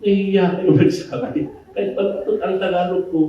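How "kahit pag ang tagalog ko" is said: ordinary delivery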